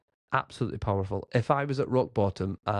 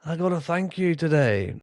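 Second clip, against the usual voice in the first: parodying a gloating coach, high and smug; mock gloating; 8 semitones higher than usual. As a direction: show off like a gloating braggart. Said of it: "I wanna gloat and say".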